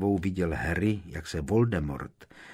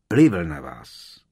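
1 rumbled; 9 dB louder, slower than usual. A narrator's reading. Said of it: "zahučel Harry".

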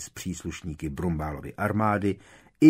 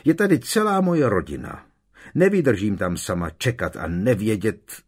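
angry tears; 4 semitones higher, 7 dB louder than usual. Acted. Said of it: "v očích se jí leskly zlostné slzy".

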